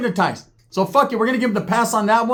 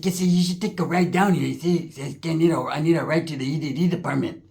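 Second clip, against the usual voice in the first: put-on Chicano accent; 3 semitones lower, 3 dB quieter than usual.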